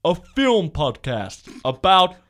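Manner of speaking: slow, loud trailer announcer; drawn-out booming movie voice. Pretend. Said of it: "should I do my movie voice?".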